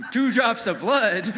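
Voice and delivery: high, loud, drawn-out disbelief; acted alarm. Boy reacts to the rabbi's ritual, loud alarmed disbelief.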